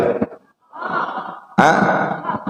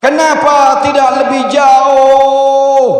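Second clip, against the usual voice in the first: retold cry; 8 semitones higher than usual. Wife retelling her husband's dying cry, high pleading. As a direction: plead upward at heaven. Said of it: "teriak lagi suami saya".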